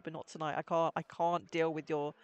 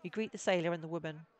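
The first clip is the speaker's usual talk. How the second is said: ordinary talk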